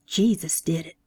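plain reading voice

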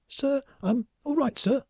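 very high, slow little squeak; meek, high-pitched phone voice; tiny startled voice. A narrator's reading. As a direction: answer as a tiny, startled underling on the line. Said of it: "A tiny startled voice".

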